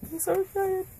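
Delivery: very high squealing excitement; excited squeal. Real, not acted. Seeing the console first time.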